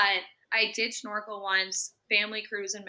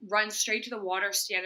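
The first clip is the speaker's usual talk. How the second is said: ordinary delivery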